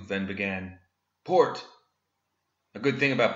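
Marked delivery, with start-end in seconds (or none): 1.2-1.6 sharp, higher, louder shout of a name; calling out. Acted. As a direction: bark the deputy's name as an order. Sheriff calls deputy to fire.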